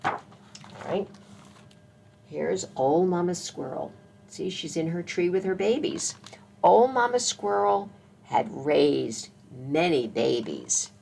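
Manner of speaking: usual speaking voice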